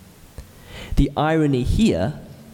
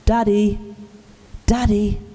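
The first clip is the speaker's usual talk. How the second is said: crying out for daddy; 8 semitones higher than usual. Retold or imitated. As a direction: call out for your father in panic. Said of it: "I began crying out".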